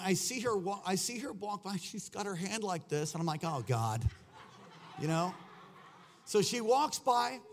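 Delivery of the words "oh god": low dismayed groan of words. Retold or imitated. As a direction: sink low on a dreading aside. Dreading her reaction to smell.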